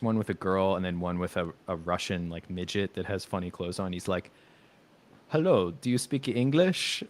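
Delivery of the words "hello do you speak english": put-on slow, higher-pitched Eastern European accent; imitated Eastern European street interviewer. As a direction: greet slowly in a mock Eastern European accent. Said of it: "that sounds Eastern European".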